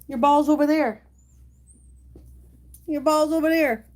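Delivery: high-pitched fetch commands; calling the dog. Real, not acted.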